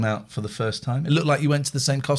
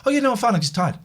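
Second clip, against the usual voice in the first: youngest son; 11 semitones higher, faster than usual. Retold or imitated. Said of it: "He went".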